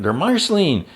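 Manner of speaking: high, startled exclamation; high mock-shocked cry; shocked gasp. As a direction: blurt it out in disbelief. Characters shocked at the turning.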